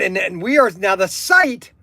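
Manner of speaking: ordinary delivery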